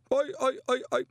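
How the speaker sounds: high, frightened stutter; stammering. A narrator's reading. Frightened young wizard stammers before the Arch-Chancellor. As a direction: stutter helplessly.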